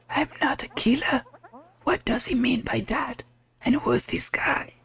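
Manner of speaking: high, hushed, bewildered questioning; puzzled captain. Acted. Captain puzzles over stranger's remark, high hushed bewilderment. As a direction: wonder aloud in a high, thin half-whisper.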